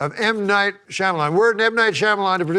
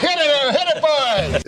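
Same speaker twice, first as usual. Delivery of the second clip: excited call; 11 semitones higher, 3 dB louder than usual. Real, not acted. Cueing the band.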